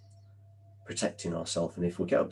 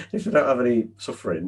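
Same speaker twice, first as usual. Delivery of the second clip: higher and louder, just out of a laugh; 5 semitones higher, 9 dB louder than usual. Real, not acted. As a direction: carry the laugh into the words. Still amused after laughing.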